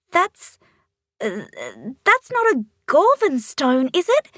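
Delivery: high, breathless alarmed question; startled girl recoiling; gasping alarm. A narrator's reading. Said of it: "she gasps".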